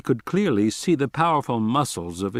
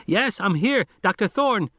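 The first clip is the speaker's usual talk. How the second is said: put-on much higher scratchy boy's voice; high scratchy radio boy answering; kid on the radio; 10 semitones higher than usual. Arby on the radio.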